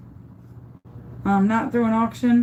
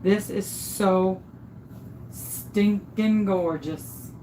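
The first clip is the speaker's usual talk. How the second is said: slow admiring; 5 dB quieter, much slower than usual. Real, not acted. Admiring her own tumbler.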